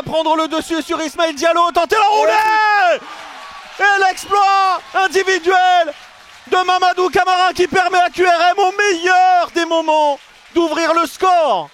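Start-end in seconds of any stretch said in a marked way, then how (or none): 1.7-11.7 shouted goal call, slowing on the words; excited sports commentary. Real, not acted. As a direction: roar it out as the goal goes in.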